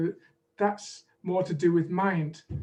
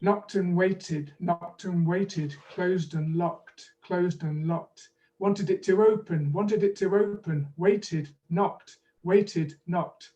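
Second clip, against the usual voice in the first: measured, deliberate poem reading; slow poem recital; slower than usual. Shown as a demonstration. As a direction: read slowly, each syllable clear. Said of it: "Each line is repeated twice".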